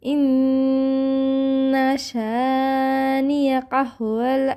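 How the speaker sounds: slow, drawn-out melodic recitation; tajweed recitation; Quran recitation. Shown as a demonstration. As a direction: chant each word long and even. Recites verse.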